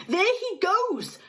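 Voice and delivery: high, slow excited call; shouting. A narrator's reading. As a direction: point and cry out. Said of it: "shouted somebody".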